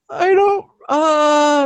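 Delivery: high, stammering panic; flustered blank. Retold or imitated. Flustered on 911 call.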